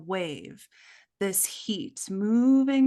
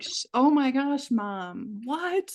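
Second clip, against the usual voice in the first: put-on higher, startled little girl; shocked child voice; 6 semitones higher, slower than usual. Daughter shocked when mom yells, startled and high. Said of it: "Yes, like".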